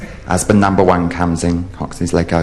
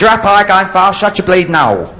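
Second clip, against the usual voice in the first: high, raised-voice rebuke; exasperated shouting; 12 semitones higher, 3 dB louder than usual. Acted. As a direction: shout it at someone hard of hearing. Shouts answer to old man.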